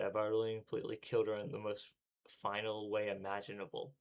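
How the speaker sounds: ordinary delivery, nothing marked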